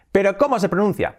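ordinary talk

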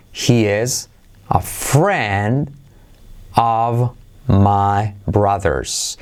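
very slow English sentence, drawn out word by word; very slow demo. Shown as a demonstration. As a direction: stretch each word for learners. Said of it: "Frase para practicar". (frase en inglés muy lenta)

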